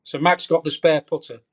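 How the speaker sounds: ordinary delivery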